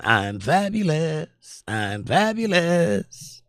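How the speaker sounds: high, slow, drawn-out vain peacock voice; strutting peacock. Pretend. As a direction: stretch out fabulous like a preening peacock. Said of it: "Peacocks are running around going".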